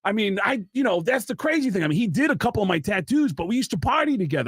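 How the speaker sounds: high-pitched, hurt protest; betrayed friend. Acted. Acts out hurt drummer defending friendship.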